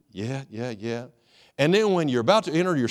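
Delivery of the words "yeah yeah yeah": awed murmur. Acted. Adam dazzled by God's picture.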